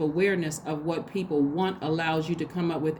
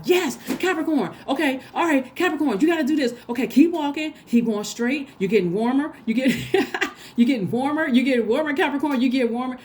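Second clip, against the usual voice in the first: high, loud cheering from the stands; root them on like a proud parent in the crowd; cheering a kid on at the game, excited and loud; 8 semitones higher, 5 dB louder than usual. Retold or imitated. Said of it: "clapping and cheering and stomping for you all".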